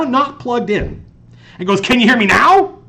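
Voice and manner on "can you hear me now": loud, high mock-furious yell; imitated angry bellow; pissed off. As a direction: shout it like someone at the end of their rope. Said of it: "this is him just being pissed off".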